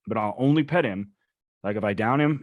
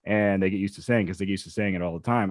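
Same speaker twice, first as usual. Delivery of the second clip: usual speaking voice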